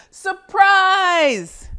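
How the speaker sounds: high, loud party shout; yelled surprise. Acted. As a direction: shout it as the lights come on. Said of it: "yelled Detective Dog's friends".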